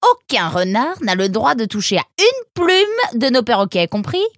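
high, emphatic reprimand; angry warning. Acted. Fox to Nemrod about touching sacred parrots, angry warning. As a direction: lay down the law loudly.

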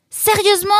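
high, incredulous retort; exasperated disbelief. Acted. Roxane refused help by Nemrod, incredulous. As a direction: throw it back in disbelief. Quarrel over who opens door.

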